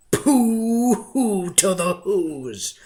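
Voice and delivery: slow, drawn-out mocking jeer; gloating Grinch. A narrator's reading. The Grinch jeers at the Whos from the mountaintop, slow and gloating. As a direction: savour the taunt.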